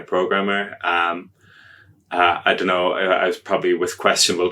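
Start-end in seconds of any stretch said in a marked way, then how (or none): none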